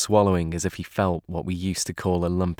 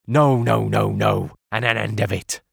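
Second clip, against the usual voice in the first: crying out. A narrator's reading. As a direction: cry it out, refusing flat. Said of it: "he cried once".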